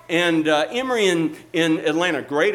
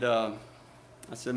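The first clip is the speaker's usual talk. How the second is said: ordinary talk, nothing marked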